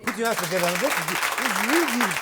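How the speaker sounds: loud, lifted host's call; rallying the audience. Real, not acted. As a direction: call out over the applause. Host rallies audience to applaud.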